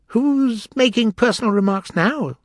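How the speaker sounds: high, triumphant retort. A narrator's reading. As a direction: score the point over an opponent. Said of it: "the Hatter asked triumphantly".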